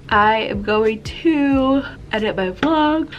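slow words swallowed by a yawn. Real, not acted. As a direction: keep talking while a yawn takes over. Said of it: "I cannot stop yawning".

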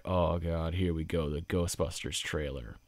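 low, soft dreading groan; imitating a resigned moviegoer's sigh; weary sigh. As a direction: mutter it under your breath, sighing. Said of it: "I did hear one audible sigh".

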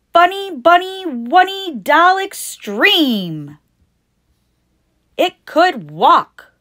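high, loud and slow like a TV toy ad; toy commercial voice. Acted. Announcing the fancy new doll to Trixie, high loud ad voice.

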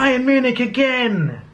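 high, slow, exasperated cry; exasperated outburst. Real, not acted. Fan reacts to the draw, high and exasperated. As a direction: let out real frustration at the bad draw. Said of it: "I'm really annoyed".

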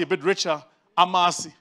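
normal talking voice

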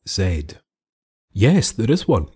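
plain reading voice

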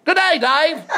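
high, loud Australian greeting; hammed-up Australian caller; Australian accent. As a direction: hail a mate in broad Australian. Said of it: "What happened to your Australian accent?".

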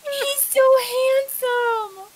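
high, slow cooing over a kitten; drawn-out delighted squeal; gushing. Real, not acted.

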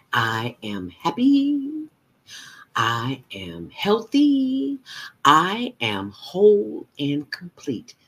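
slow confident affirmations; slow proud affirmations. Shown as a demonstration. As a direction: declare each with conviction. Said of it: "say it like you mean it".

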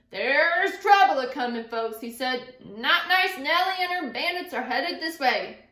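loud, urgent warning; warning cry. Acted. Sweaty rider warning the townsfolk about the bandits, urgent. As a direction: call out the danger to the whole crowd.

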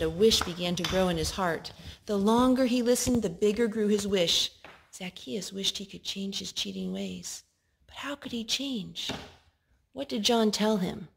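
ordinary delivery

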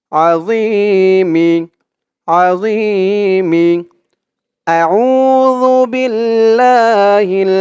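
আউযু and Billah chanted slowly; drawn-out chant for repeating; slow recitation. Shown as a demonstration. Teacher leads students in slow chant. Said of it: "তো আমার সাথে বলুন".

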